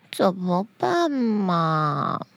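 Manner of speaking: put-on slow, sinking child's whine; discouraged. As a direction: let it droop helplessly, low and drawn out. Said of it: "小慧觉得好气馁哦".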